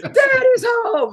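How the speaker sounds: put-on high, slow, gleeful kid's shout; squealing toddler greeting dad at the door; child shouting daddy's home. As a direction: call out like an excited little kid. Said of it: "as soon as I went in the house".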